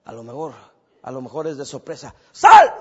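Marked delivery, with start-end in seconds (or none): 2.3-2.7 sudden shriek. Acted. Surprise attempt at exorcism.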